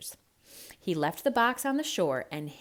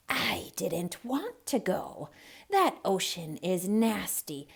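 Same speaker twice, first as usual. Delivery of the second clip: put-on a sly old serpent taking his time; old snake. Old snake explains himself.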